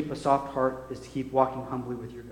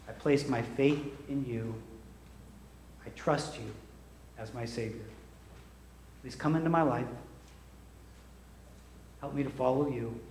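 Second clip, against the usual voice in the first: hushed prayer; 5 semitones lower, 13 dB quieter than usual. Acted. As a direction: pray quietly over the congregation. Leads salvation prayer.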